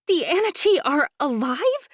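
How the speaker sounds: high, loud shocked squeak. Acted. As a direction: squeak it out half thrilled. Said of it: "Imogen squeaked".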